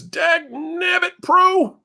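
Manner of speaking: high, slow gold-miner twang; lightly voiced old prospector. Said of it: "I should speak with an old prospector's voice".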